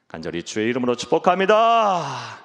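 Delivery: loud swelling proclamation; preacher's blessing. Real, not acted.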